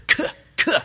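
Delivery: sharp voiceless k clicks spat out; spat k sounds. Shown as a demonstration. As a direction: spit the k like a curse. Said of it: "hear him spitting out the k".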